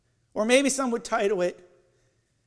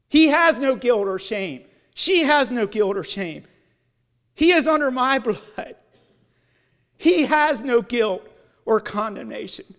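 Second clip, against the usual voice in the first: loud, emphatic declaration; proclaiming; 7 dB louder than usual. Acted. Pastor proclaiming forgiveness to the church, loud emphatic. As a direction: declare it over them. Proclaiming innocence, sermon climax.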